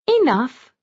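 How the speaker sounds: sugary one-word reply to the suspicious husband; sweetly. A narrator's reading. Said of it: "she said sweetly".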